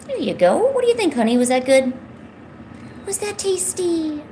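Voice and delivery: high sing-song cooing at a cat; pet talk. Real, not acted. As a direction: coo at the kitty, pitch way up.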